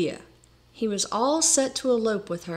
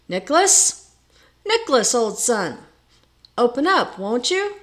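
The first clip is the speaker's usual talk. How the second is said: loud, raised call through a door; 4 semitones higher, 6 dB louder than usual. A narrator's reading. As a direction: call for him to open up. Hammering on the lodger's door.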